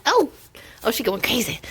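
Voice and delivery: usual speaking voice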